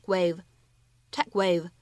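"tech wave" said slowly, the k held and not released before "wave"; incomplete plosion demo. Shown as a demonstration. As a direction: hold back the k in "tech wave". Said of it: "我们可以读成tech wave". (慢速示范"tech wave"，k不完全爆破)

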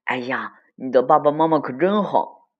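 put-on loud, high admiring kid voice; swelling, envious exclamation. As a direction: gush with envy at your friend's luck. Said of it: "小伙伴们非常羡慕可可".

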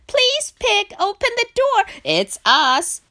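high, loud plea at the door; frantic shout. A narrator's reading. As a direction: yell pleadingly, high and urgent. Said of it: "shouted Frog and Duck".

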